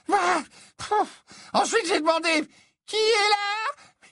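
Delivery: very high, slow, stammering seer; a dithering prophetess confessing reluctantly; flustered seer. Acted. Trelawney retells calling out.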